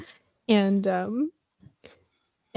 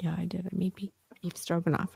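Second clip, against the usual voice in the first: much softer, quick and hushed; sheepish hush; 9 dB quieter, faster than usual. Retold or imitated. Stepdad admitting he cooked stroganoff once, hushed and sheepish. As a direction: mumble it like a guilty admission.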